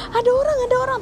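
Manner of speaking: very high, near-crying alarm; wailed warning, acted out at high pitch; retold panic. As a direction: re-enact a panicked cry of alarm. Re-enacts alarm to neighbours.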